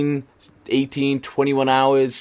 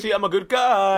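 high-pitched mock show-off; mock boasting; 9 semitones higher than usual. Co-host posing for a charity selfie, high mock boasting. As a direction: play the do-gooder, pitched way up.

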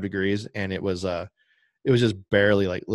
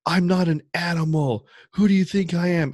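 high, louder indignant outburst; retold angry shout, pitched high; mock yell; 9 semitones higher, 5 dB louder than usual. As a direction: imitate the driver yelling back. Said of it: "started yelling at me".

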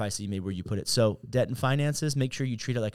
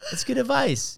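high-pitched amused repeat; amused exclamation; tickled approval; 12 semitones higher than usual. Real, not acted. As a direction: say it high, still getting over a laugh. Repeats punchline, still amused.

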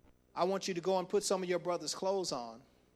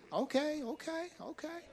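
high, rising okays, getting softer; mock agreement; 9 semitones higher, 4 dB quieter than usual. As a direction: humour them in a high sing-song. Mock agreement with headstrong young person.

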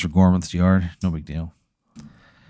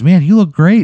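delighted compliment; 9 semitones higher, 5 dB louder than usual. Acted. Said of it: "she goes".

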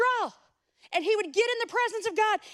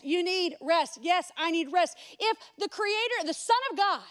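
normal talking voice

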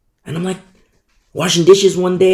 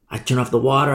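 ordinary talk, nothing marked

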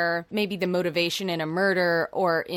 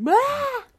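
mock scream; 5 dB louder than usual. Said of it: "And be like".

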